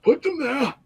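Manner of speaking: high, stern wizard command; Zetrix's voice. Acted. Wizard Zetrix ordering captives placed, high and stern. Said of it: "Zetrix says".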